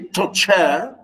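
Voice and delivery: "torture" with a rising, stretched "-ture"; stress on second syllable. Shown as a demonstration. Teacher repeating the wrong version, "torture" stressed on last syllable. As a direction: shift the beat of "torture" onto its end. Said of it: "We don't say torture".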